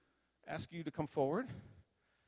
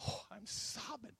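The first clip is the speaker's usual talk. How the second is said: high, soft, drawn-out mock wail; slow, hushed imitation of sobbing; retold sobbing; 9 semitones higher, 7 dB quieter than usual. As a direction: act out being overcome, softly. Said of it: "and I start sobbing".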